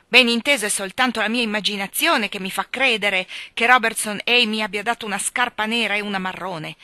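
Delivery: loud, mocking and bitter; heavy sarcasm, building to a rant; sarcastic. A narrator's reading. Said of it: "con tono sarcastico". (sarcasmo forte e amaro)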